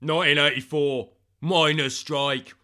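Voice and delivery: hammed-up veteran of the miners' strike; put-on tough-guy voice. Recalling eighty-four to colleague, put-on tough voice. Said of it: "Why you got a voice?".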